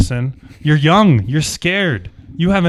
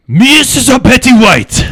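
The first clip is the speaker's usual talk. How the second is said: high, loud, slow shout of the name; shouted call; 11 semitones higher, 11 dB louder, slower than usual. Acted. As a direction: bellow the name across the street. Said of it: "somebody turns around and says".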